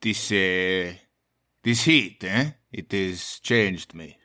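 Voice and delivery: low, slow French character; French accent. Acted. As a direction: put on a weary-paced French accent. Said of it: "you recognize that mine was French".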